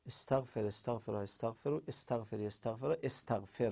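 low, soft run through verb forms; quiet, low trial recitation; imitated self-muttering. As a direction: imitate a student testing forms under the breath. Acts student testing conjugation forms.

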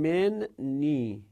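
من نی said slowly, min and ni split apart; teacher sounding out من نی slowly; split doubled n. Shown as a demonstration. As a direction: draw out من نی to show the nun al-wiqaya. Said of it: "این هم نون وقایه".

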